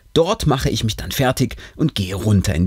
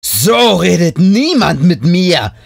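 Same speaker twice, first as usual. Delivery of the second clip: louder, slow, furious outburst; 7 semitones higher, 7 dB louder, slower than usual. A narrator's reading. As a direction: bellow your wounded pride at her. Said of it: "In ihrer Stimme kann man auch so klar und deutlich die Wut hören".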